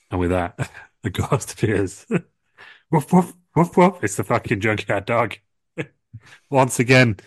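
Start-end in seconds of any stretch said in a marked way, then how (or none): 2.9-4.0 high, playful woof woof barking; mock dog bark. The ghost of Junkyard Dog appears, playful high barking.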